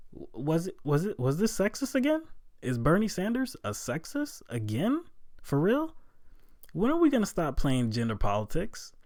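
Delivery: can't believe what he's hearing; incredulous. Real, not acted. Indignant rant at coverage.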